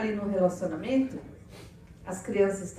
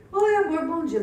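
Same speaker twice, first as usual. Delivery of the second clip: high, loud sugary "oi amor"; husband's sweet greeting; 6 semitones higher, 7 dB louder than usual. Retold or imitated. Husband greets his moody wife with a sugary hello. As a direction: chirp it like an eager husband.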